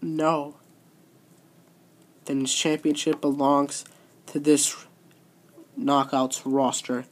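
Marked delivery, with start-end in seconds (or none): none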